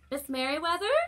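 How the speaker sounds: high, loud questioning call; puzzled loud call. Acted. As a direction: call her name into the room. Calling into empty office.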